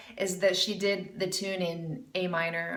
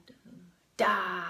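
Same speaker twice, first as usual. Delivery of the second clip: normal talking voice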